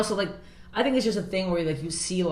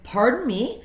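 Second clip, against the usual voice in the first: high, louder questioning retort; retold indignation; 8 semitones higher, 5 dB louder, slower than usual. Comic retelling her reply to a heckling guy, indignant. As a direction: pitch it up in mock disbelief. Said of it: "And I went".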